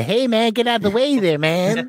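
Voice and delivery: high-pitched put-on New York accent; mock New Yorker. Co-host mimicking a New Yorker he claims never to have heard, mock New York accent. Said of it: "people who talk like that".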